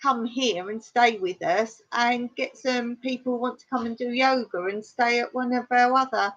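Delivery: ordinary talk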